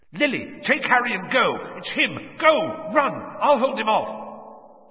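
high, urgent panicked cries; a father shouting to save his family; panicked shouts of a father. A narrator's reading. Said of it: "shouting, panicking".

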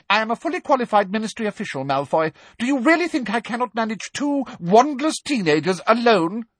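fast, cutting rebuke; sharp. A narrator's reading. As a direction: cut him down quickly. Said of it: "asked Umbridge sharply".

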